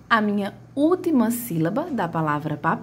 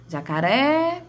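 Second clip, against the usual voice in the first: "jacaré" called out higher, stretched on the final "ré"; "jacaré" with a long, raised "ré"; calling out stretched; 5 semitones higher, slower than usual. Shown as a demonstration. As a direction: hold the last syllable of "jacaré" as if calling someone.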